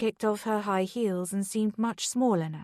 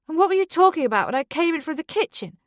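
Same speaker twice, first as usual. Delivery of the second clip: high, loud and fast; angry shout; 8 semitones higher, 6 dB louder, faster than usual. Acted. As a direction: fire it at him. Beth accuses Charlie.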